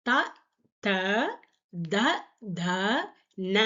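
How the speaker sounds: த த த த ந said apart, the last ந dental; Hindi ta-row recited. Shown as a demonstration. Teacher to students, reciting the dental row clearly. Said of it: "தா வரிசை".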